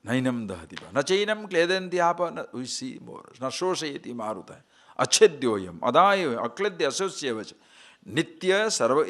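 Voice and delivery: reciting Gita verses; verse recitation. Acted. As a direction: intone the scripture line by line.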